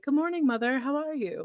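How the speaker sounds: a hint of an angelic child, all innocence; sweet polite boy. To his mother after a tantrum, a light sweet boy voice. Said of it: "be the sweet boy".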